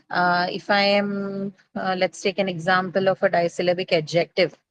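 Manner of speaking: normal talking voice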